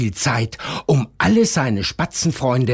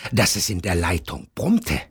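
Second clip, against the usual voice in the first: ordinary delivery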